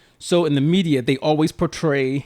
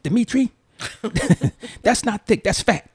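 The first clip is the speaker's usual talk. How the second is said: put-on light Nigerian accent, raised pitch; imitating a Nigerian woman's accent; 8 semitones higher than usual. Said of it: "her Nigerian accent".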